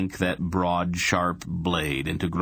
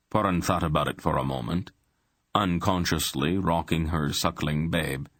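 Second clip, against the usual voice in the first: ordinary delivery, nothing marked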